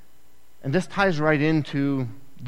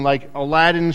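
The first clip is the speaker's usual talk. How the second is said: normal talking voice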